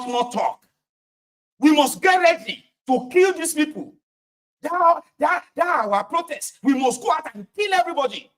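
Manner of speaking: high, heated mock rallying cry; hothead agitator. Acting agitator who takes over discussion.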